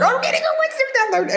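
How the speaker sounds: very high, thrilled announcement; excited. Retold or imitated. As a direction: squeal it with delight. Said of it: "I was like".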